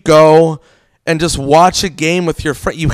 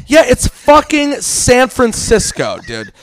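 higher, drawn-out incredulous reply; exasperated retort; 8 semitones higher, slower than usual. Retold or imitated. Teller answering his buddy on the phone, exasperated. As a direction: act out being fed up with a friend.

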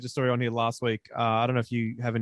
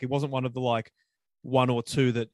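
normal talking voice

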